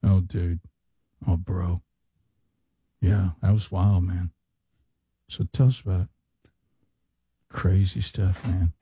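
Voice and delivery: lazy podcast host; mocking podcast bros. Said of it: "this is how they talk".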